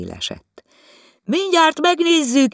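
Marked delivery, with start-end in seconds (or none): 1.2-2.5 calling loudly down from the roof, high and loud; chimney sweep calling down from the rooftop, loud; shouting down. A narrator's reading. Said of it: "kiabált le Báthori úr".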